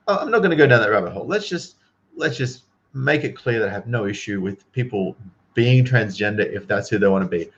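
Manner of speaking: ordinary talk, nothing marked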